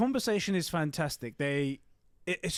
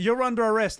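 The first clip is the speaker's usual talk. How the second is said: loud, raised-pitch retold command; mock arrest; 7 semitones higher, 7 dB louder than usual. Host retells the arrest that breaks up their talk, commanding. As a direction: call it out like an officer bursting in. Repeats Walker's bursting-in arrest line.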